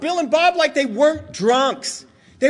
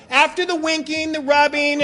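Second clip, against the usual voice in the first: usual speaking voice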